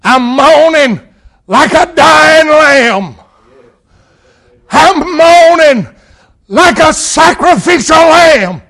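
high, loud, drawn-out wail of agony; moaning shout of a suffering Christ; anguished cry of Jesus. Acted. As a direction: cry it out like a dying lamb. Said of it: "Jesus is saying".

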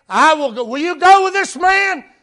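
high, loud altar-call challenge; full-force preaching. Real, not acted. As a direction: press the question on the congregation.

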